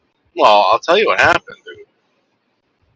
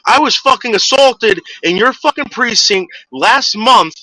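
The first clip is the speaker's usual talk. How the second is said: much higher, imitated angry yelling; retold furious screaming; 10 semitones higher than usual. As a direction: imitate him yelling at the cop. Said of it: "he's screaming at this fucking cop".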